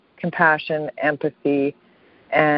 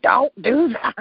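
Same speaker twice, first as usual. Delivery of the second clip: higher-pitched telling-off; scolding husband; 8 semitones higher, slower than usual. Retold or imitated. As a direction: stop him right there, pitched up. Scolding husband over crying rule.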